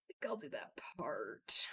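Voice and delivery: soft, slow whisper; whispered aside. Retold or imitated. Mom quietly taking the card back from her kid, whispering. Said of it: "But the mom was like".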